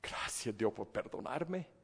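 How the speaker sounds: hushed, slow thanks to God; soft grateful prayer. Shown as a demonstration. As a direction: breathe out the thanks. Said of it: "qué debería ser el cristiano". (oración suave y agradecida)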